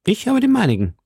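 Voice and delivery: put-on high, eager young musketeer; calling out. A narrator's reading. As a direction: report it keenly, pitched well up. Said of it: "rief D'Artagnan". (hoch und eifrig gerufen)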